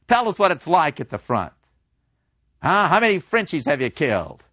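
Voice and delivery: put-on old drinkers grilling the young soldier; eager old men. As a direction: pester the soldier for war stories.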